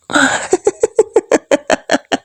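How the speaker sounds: high gloating giggle; sinister little giggle; creepy giggle. Acted. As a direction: laugh softly at his helplessness. Wife gloats over drugged husband.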